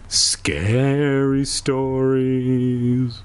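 hugely drawn-out, eerie character line; spooky drawl. Acted. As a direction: stretch each word like a ghost story title. Character teasingly stretches the phrase.

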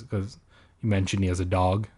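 ordinary talk

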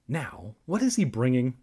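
usual speaking voice

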